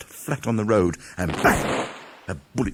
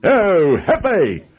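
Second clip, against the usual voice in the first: high, loud outraged exclamation; indignant Toad; 10 semitones higher, 8 dB louder, much slower than usual. Acted. Toad to Rat, hearing of the weasels, outraged. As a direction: bristle with offended surprise. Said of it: "said Toad".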